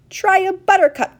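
high, loud, eager urging; excited cry. A narrator's reading. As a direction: call it out with glee. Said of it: "cried Mister Wonka".

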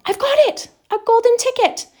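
high, loud shout of discovery; excited yell. Retold or imitated. Factory worker shouts that she found the ticket, high excited yell. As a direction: yell out the find. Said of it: "one of my women workers yelled".

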